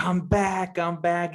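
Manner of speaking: high-pitched, mock-excited quote. Co-host recapping John Walker's new costume, excitedly. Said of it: "He's all excited".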